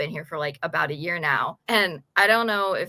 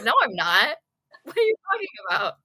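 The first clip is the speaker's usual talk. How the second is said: high-pitched, quick mock protest; mock indignant denial; 6 semitones higher, 4 dB louder, faster than usual. Student retelling her protest to her mom, mock indignant. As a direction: play up the offended denial, half laughing.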